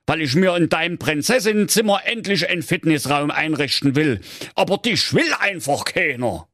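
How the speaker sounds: put-on gruff old king in Saxon dialect; a grumbling king fed up with his daughter; grumpy Saxon king. A narrator's reading. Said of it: "sprach zu seiner Tochter".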